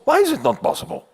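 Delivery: the mouse fires back fast and high. Acted. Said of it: "And the mouse says".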